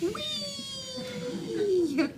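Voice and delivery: long high whee falling down; roller coaster whee. Shown as a demonstration. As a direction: sweep it down like a coaster drop. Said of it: "Want me to do that again?".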